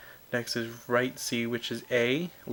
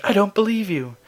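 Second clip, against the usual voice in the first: higher, louder mock-stern teacher; teacher, mocking disbelief; 9 semitones higher, 10 dB louder than usual. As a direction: throw the doubt back at the student, loud and high. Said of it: "And she'd be like".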